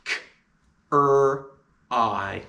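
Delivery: k, er, I separated by pauses; sounding out cry. Shown as a demonstration. Teacher sounding out cry. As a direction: pull "cry" apart sound by sound. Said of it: "And the sounds of cry are".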